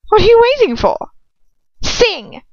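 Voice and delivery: high, loud, fast order; shrill command. A narrator's reading. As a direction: bark it high and hard like a tyrant. Bellatrix orders singing.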